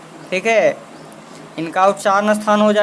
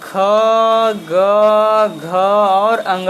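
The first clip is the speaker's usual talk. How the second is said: slow letter demo; much slower than usual. Shown as a demonstration. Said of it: "लिखते हैं पहले क वर्ग".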